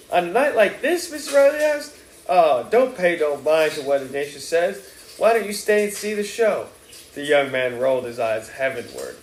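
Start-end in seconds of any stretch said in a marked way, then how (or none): 0.1-6.7 a hint of a tipsy New Orleans mother, slightly higher; lightly voiced Mrs Riley. A narrator's reading. Mother smoothing things over at the bar, lightly voiced. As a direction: lightly play the chatty mother.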